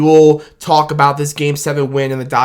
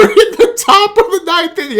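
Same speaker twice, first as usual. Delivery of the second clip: laughing; 12 semitones higher than usual. Real, not acted. Laughing while recounting homer.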